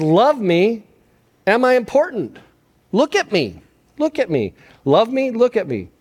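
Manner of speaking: put-on needy child. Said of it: "kids are asking".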